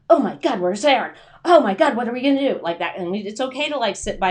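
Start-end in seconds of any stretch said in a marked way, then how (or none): 0.1-2.6 loud, higher panicked shriek; mock panic. Panicking brain at a rave, shouting loud and high. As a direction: yell it like a partier who lost a friend at a rave. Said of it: "and they're like".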